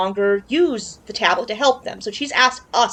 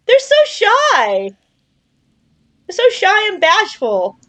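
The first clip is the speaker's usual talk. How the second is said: cooing; 9 semitones higher, 4 dB louder, slower than usual. Real, not acted. Cooing at shy creature.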